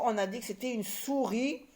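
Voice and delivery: normal talking voice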